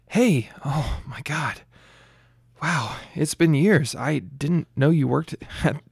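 slow, hushed then rising delight; breathless surprise. Acted. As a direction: gasp it out, then warm up. Delighted reunion after years.

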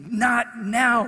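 menacing warning. Retold or imitated. Lookout turns on the preacher, menacing warning. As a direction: imitate a lookout's hard, punched warning. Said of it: "like that".

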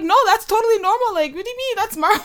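high, defensive explaining; defensive kid. Retold or imitated. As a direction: protest up high, baffled. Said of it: "I'm like".

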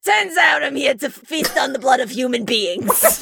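hammed-up, smoke-roughened impression voice; Danny DeVito. Host voicing a vampire DeVito for friends, hammed-up smoker's rasp. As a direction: croak it like forty cigarettes, playing the celebrity vampire. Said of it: "time to whap out my, uh, Danny DeVito impression".

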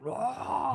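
high-pitched drawn-out mock roar; imitated monster roar. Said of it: "you see his teeth and he's like".

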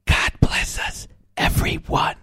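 put-on whispered little-boy line; tiny child voice. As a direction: breathe it like a small child. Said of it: "and says".

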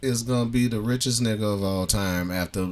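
ordinary delivery

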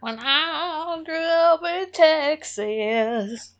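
put-on slow, sung-ish southern accent; bad southern accent. Joking about Texas with cohost, bad southern accent. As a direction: croon it in a hammed-up southern accent. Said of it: "I have a bad southern accent".

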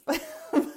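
breathy high giggle. Real, not acted. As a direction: burst into a giggle. Bursts out laughing.

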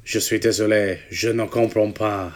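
low, soft mock French; creepy French accent. Co-host pretends not to understand the host, creepy French accent. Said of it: "now you're just sounding a bit creepy".

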